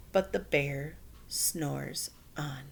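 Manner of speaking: slow, soft, drowsy refrain; sleepy refrain. A narrator's reading. Reading the repeated line to children, slow and sleepy. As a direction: let it drift off like sleep.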